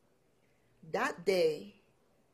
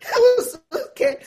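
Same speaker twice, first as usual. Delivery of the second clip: much higher and louder, near crying; tearful fear; 13 semitones higher, 13 dB louder than usual. Real, not acted. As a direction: voice breaking at so scared. Recalling fear, cry heard.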